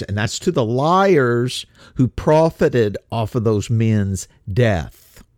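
normal talking voice

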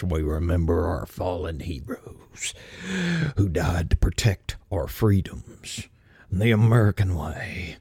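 hushed reverent whisper of a patriotic American; mock-solemn patriotic whisper; quintessential American voice. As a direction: breathe it out like a solemn flag-waving tribute. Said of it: "to do my quintessential American voice".

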